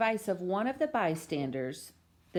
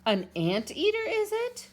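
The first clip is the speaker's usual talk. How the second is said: puzzled neighbor; 3 dB louder than usual. Acted.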